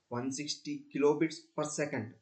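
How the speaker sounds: ordinary talk, nothing marked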